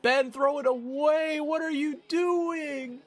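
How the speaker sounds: real frustrated call at the screen; exasperated shout at Ben; yelling at the game. Real, not acted. Yelling at his quarterback in game.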